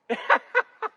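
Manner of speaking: short burst of high-pitched laughter. Real, not acted. Storyteller laughing after his punchline, high cackle. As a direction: laugh out high at your own joke.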